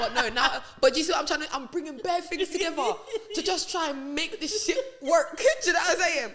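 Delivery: loud and giggling; laughing. Real, not acted. Telling friends about lying, loud laughter. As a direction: laugh through the whole thought.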